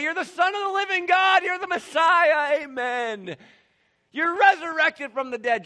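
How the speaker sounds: loud, high, shouting the confession; eager declaration. Pretend. Disciples answering Jesus, a loud excited shout. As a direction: cry it out like a thrilled disciple. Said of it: "We're gonna say".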